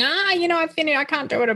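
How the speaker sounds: put-on lightly whiny kid giving up; mock frustrated kid. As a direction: play the fed-up son who gives up. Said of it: "he's frustrated and going".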